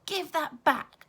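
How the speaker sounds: loud, high, slow shout; child shouting at the bully to return the gift. Acted. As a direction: yell it out, scared and angry. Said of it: "I shouted".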